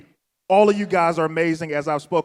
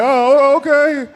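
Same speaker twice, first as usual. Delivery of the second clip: awkward; 9 semitones higher, 4 dB louder than usual. Retold or imitated. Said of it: "It was so awkward".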